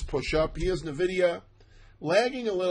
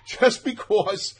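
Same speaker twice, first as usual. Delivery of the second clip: real laughter breaking into the words, louder and higher; can't stop laughing while talking; 6 semitones higher, 9 dB louder, slower than usual. Real, not acted. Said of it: "I'm laughing so, so hard to myself".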